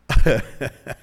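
short, loud burst of laughter; real laugh. Real, not acted. Preacher amused after joking about his wife, real laugh. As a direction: laugh out at your own joke.

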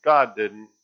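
loud, triumphant gloat. Acted. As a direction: jump out and crow over her. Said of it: "he said".

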